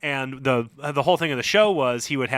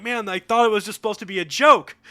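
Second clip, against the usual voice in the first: higher, louder complaint; dream self; 11 semitones higher, 6 dB louder than usual. Retold or imitated. Dreamer fighting off the zombie lady, higher and louder. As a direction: protest like it was meant as a joke. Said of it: "And I was like".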